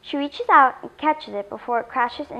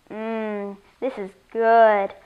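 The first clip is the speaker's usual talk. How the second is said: slow hum, then a loud, drawn-out "good"; savouring; 4 dB louder, much slower than usual. Acted. Alien tastes first bubble gum, savouring. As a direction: relish it, stretching the last word.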